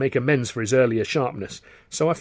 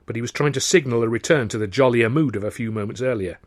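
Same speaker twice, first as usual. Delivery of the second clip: ordinary delivery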